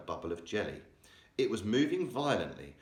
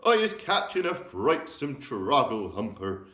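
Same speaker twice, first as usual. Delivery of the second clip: loud cry; 5 semitones higher, 7 dB louder than usual. A narrator's reading. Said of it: "he cried".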